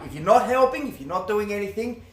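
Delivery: ordinary delivery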